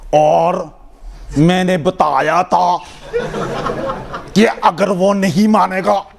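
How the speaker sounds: booming, drawn-out Urdu rally speech; mock fiery speech in Urdu; shouting orator. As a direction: imitate an overblown speaker hammering out each word. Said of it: "So therefore you gotta say".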